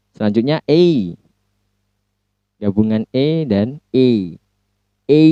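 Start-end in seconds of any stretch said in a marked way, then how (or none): 5.1-5.2 "A" said as the ei glide of pay; diphthong demo. Shown as a demonstration. Teacher modelling ei for students. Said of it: "Seperti dalam kata pay".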